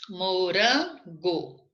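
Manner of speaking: "Morango" very slowly, split into syllables, leaning on RAN; syllable breakdown. Shown as a demonstration. Teacher checking a word's stressed syllable, "Morango" slowly broken up. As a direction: stretch the RAN of "Morango", then set GO apart. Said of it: "Não é proparoxítona".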